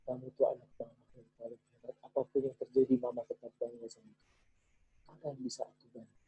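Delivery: very soft, hushed, tender reassurance; a mother whispering quiet, loving pride; tender affirmation. Shown as a demonstration. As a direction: murmur it while hugging the child. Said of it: "dan akui".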